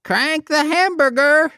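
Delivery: slow, high goofy voice mangling the word; silly high voice. Pretend. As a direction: play the dimwit mishearing, drawn out. Dan's falsetto bit character.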